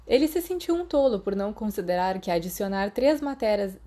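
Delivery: usual speaking voice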